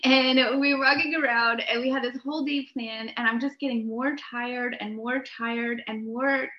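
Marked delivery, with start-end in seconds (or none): none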